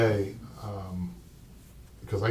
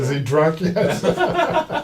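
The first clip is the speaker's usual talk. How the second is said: laughing; 6 semitones higher, 6 dB louder, much slower than usual. Real, not acted. Joking about the drinking game.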